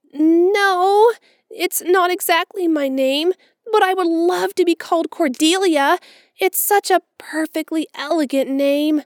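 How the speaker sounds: put-on high, loud, gushing girl's voice; a romantic child longing for a grand name; dreamy orphan girl. A narrator's reading. Orphan girl to Marilla, gushing high and loud.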